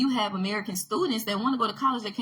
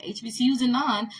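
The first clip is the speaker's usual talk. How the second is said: ordinary delivery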